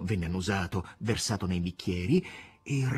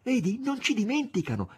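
high, eager aside; excited aside; 11 semitones higher than usual. Acted. Margherita to the master, high and eager. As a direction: share the happy news. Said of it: "E dopo aver sussurrato al maestro".

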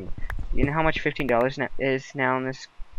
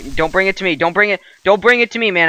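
panicked; 7 semitones higher, 6 dB louder than usual. Real, not acted. Creeper coming, begging friend.

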